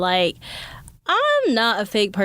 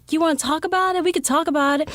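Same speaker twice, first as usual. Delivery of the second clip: higher, gentle coaxing voice; gentle offer; 8 semitones higher, faster than usual. Retold or imitated.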